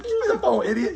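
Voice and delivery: high, loud laughing shout; frantic mock yell, cracking up; yelled through laughter. Friends yelling at Ernest to grab the phone, laughing shout.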